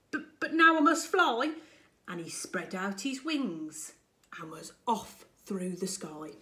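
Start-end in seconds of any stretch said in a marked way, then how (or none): 0.1-1.5 nervous stammer. Acted. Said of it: "he spluttered".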